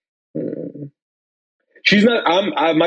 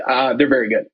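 usual speaking voice

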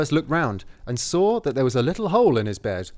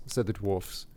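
ordinary delivery

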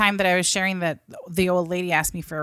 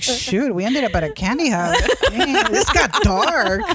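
mock dismay; 6 semitones higher, 5 dB louder than usual. Jokingly acts out shocked realization.

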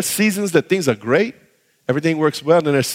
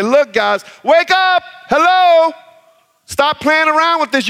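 urgent warning call; 9 semitones higher, 4 dB louder than usual. Acted. Preacher voicing urgent warning.

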